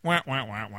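muffled trombone wah wah; wah wah adult talk. Pretend. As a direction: drone it like a sad horn. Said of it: "What, what would the nerf sound like?".

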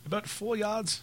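high mock Canadian accent. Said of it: "slight Canadian accent".

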